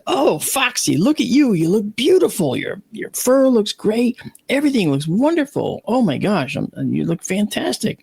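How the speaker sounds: high-pitched cooing over a beloved animal; acted-out joyful admiration, voice way up; gushing delight. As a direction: gush as if greeting a healed pet. Acts greeting the healed fox.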